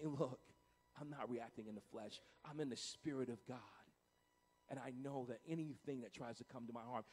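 low, soft, composed assurance; calm self-assurance. Acted. Believer answering provocation from the Spirit, calm and low. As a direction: settle down low and speak with quiet certainty.

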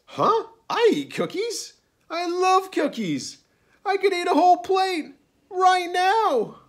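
very high, loud, worked-up crocodile; indignant protest. A narrator's reading. Crocodile insists on loving cookies, indignantly. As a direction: protest at the top of your range.

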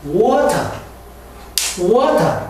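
British water with a crisp T, "wo-ta"; water in a British accent, "wo-ta". Shown as a demonstration. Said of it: "영국에서는".

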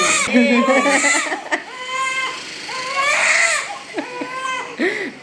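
a long high laugh breaking over the crying. Real, not acted. Mother laughing at her crying toddler, long and high. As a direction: laugh out high, helpless and amused.

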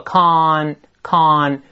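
"con" drawn out with an open "ah"; long full-vowel "con", tongue up for the n; stressed-form con. Shown as a demonstration. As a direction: say "con" slowly, ah closing into a front n. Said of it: "中间发像字母A一样的啊".

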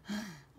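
short dramatic gasp; quick storyteller's gasp. Acted. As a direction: gasp in alarm for young listeners. Mock suspense at chapter title.

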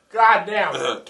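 ordinary talk

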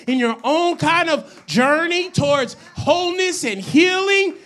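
full-force preaching. Real, not acted. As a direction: build item by item to a loud, stretched-out charge. Preaches building list.

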